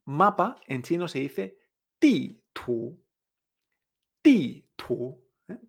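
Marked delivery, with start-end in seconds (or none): 2.0-5.2 "ti" drops sharply, "tu" climbs back up; falling then rising tones. Shown as a demonstration. Teacher giving Chinese for map, "ti" falling and "tu" rising. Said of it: "Baja y sube".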